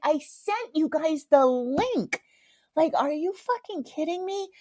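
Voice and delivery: high, slow, exasperated re-enactment; pissed off. Retold or imitated. Daughter scolds family who ignored her link, pissed off. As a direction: spell out the grievance in high-pitched indignation. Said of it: "I was so pissed off".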